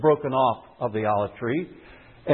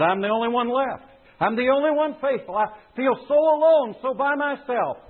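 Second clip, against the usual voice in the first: Elijah's voice, much higher, forlorn complaint; Elijah lamenting; 9 semitones higher than usual. Acted. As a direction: cry out as the lonely prophet. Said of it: "He said".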